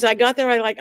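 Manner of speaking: normal talking voice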